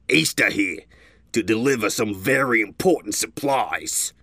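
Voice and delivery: lightly voiced gruff stallion; a hint of a proud caravan leader; gray stallion leader. A narrator's reading. Leader explains the delay.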